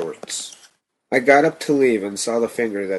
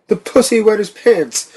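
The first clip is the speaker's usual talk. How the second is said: high, loud cackling taunt; maniacal laughing; 12 semitones higher, 7 dB louder than usual. A narrator's reading. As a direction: laugh it out, jeering. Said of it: "another burst of his maniacal laughter".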